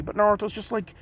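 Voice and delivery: normal talking voice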